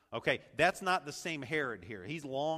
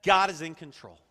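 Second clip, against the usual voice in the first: loud, raised, emphatic agreement; confident affirmation; 5 semitones higher, 10 dB louder than usual. Acted. Preacher voices what believers would say intellectually, confident declaration. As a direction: declare it with full certainty. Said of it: "we would say".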